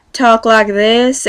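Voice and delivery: slightly drawled southern American twang; mock southern accent. Correcting viewers who think Americans talk so, southern accent. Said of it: "like, a little southern accent".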